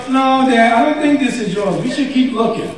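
put-on high, gentle kid voice; patient child. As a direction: let a five-year-old let Dad down gently. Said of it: "And he goes".

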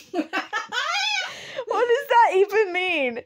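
high, drawn-out laughing question; baffled and cracking up; laughing disbelief. Real, not acted. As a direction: ask it through helpless laughter. Cracking up at the sign.

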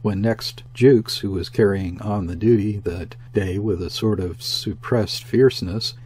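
ordinary delivery

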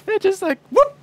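high, loud swooping whoop; cartoon sound effect of the flip; mock whoop. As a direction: sound out the bike flipping.

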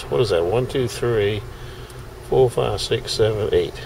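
ordinary talk

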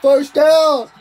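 high, loud yelling celebration; excited shout. Real, not acted. Gamer celebrating a first down, yelling excitedly. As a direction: scream it like your team just scored. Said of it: "I'm getting too excited".

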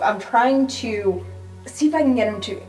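normal talking voice

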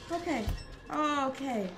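ordinary talk, nothing marked

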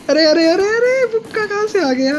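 rising, alarmed outburst; startled alarm. Real, not acted. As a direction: yelp as if ambushed out of nowhere. Caught by surprise in game.

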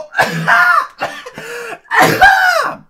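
loud high choking cough; violent coughing. Real, not acted. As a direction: cough as if dying. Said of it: "j'étais en train de mourir".

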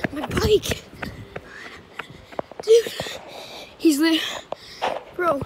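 high, loud alarm; panicked shout. Real, not acted. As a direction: yell in sudden panic. Bike about to be taken.

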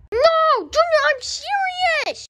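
squeaky high voice, shouting in panic; high-pitched yelling kid; high cartoon kid voice. Character insists.

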